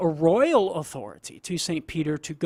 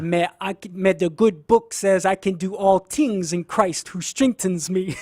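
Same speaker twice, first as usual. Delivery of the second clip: thick Cajun accent, "things" said as "tings"; Cajun dialect; 2 semitones higher, 4 dB louder than usual. Shown as a demonstration. Guest replaying his interview answer for fun, thick Cajun dialect. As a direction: lay on the Cajun, quoting the good book. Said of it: "in sort of the Cajun dialect".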